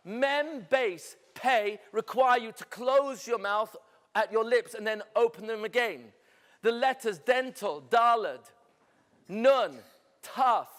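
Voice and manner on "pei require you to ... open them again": normal talking voice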